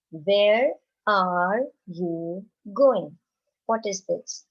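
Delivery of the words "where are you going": very slow repeated question; teacher repeating the question; slow repeat for class. Shown as a demonstration. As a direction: repeat it even more slowly for the children. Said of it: "another sentence".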